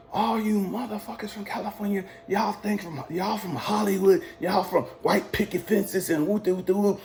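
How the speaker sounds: high-pitched imitation of a ranting commander; mock going off. Commander goes off on recruits from California, mock rant. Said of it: "he just starts going off".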